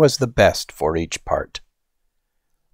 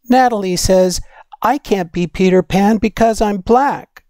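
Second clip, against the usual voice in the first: put-on higher, childlike voice telling Ma her hurt; upset little girl; 9 semitones higher than usual. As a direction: play a young girl complaining to her mother.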